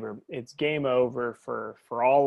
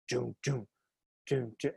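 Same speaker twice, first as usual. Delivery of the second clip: imitated heartbeat; 8 dB quieter than usual. Describing the film's music to a friend, imitated heartbeat. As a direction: imitate a heartbeat like train tracks. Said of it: "It almost sounds like train tracks".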